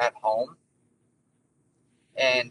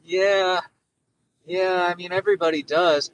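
reluctant dad; 4 semitones higher, 4 dB louder than usual. Retold or imitated. Dad admits death to five-year-old, acted reluctance. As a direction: break it awkwardly, with long pauses between words. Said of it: "he's gotta be like".

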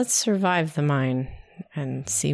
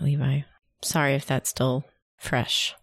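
normal talking voice

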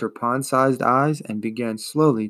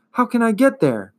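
loud; 10 semitones higher, 5 dB louder than usual. A narrator's reading. Atreyu pleads loudly.

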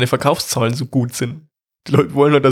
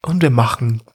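guided meditation voice. Retold or imitated. Parodies meditation app.

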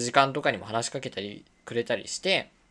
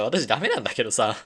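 normal talking voice

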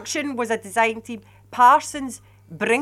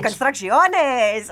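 Spanish relish; 2 semitones higher, much slower than usual. Real, not acted. Dan relishing the Spanish name, slow Spanish accent. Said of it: "I love the Spanish. It's so musical".

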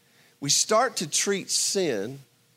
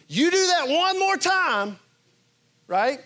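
much higher, put-on scolding parent voice; emphatic parent threat; 10 semitones higher, 3 dB louder than usual. Preacher imitating a parent warning children, high and emphatic. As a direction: threaten the kid like a fed-up parent.

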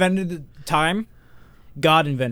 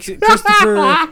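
much higher, louder, stretched out; mock puzzled squeak; 9 dB louder, much slower than usual. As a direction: squeal the name in confusion. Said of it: "I was like".

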